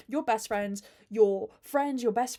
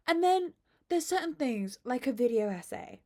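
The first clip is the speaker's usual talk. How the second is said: usual speaking voice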